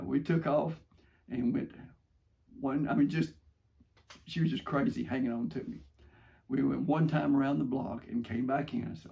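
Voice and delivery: ordinary talk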